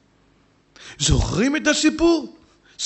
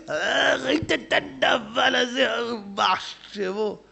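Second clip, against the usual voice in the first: mocking gossip; 6 dB quieter, much slower than usual.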